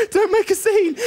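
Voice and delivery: high, loud hissed plea; begging mum not to make a scene; urgent plea. Retold or imitated. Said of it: "just going".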